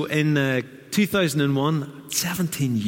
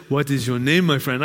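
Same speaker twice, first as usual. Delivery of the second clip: imitated African accent, louder opening; 4 dB louder than usual. Man greets a newcomer in a Ghanaian church, African accent. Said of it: "I'll start with the African accent".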